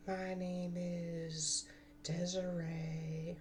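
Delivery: high breathy whisper, slowed way down; soft airy girly whisper, drawn out. Pretend.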